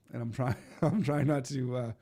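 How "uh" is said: normal talking voice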